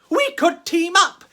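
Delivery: a lonely ghost sensing a friend; high, bright-eyed eagerness; hopeful ghost girl. Acted. Elizabeth's hopeful thought.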